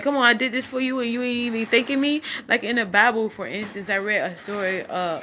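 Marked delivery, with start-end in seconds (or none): 0.0-2.2 God complaining. Retold or imitated. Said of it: "he gon', like".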